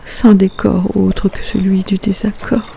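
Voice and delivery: measured poem reading, phrase by phrase with pauses; poetry recitation. Acted. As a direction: recite the poem line by line, letting each phrase stand apart. Recites her poem Tumulte.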